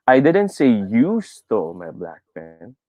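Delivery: single out "you" with a long lift; stress on YOU. Shown as a demonstration. Teacher demonstrating stress on the fourth word, "you". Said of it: "I put my emphasis on you".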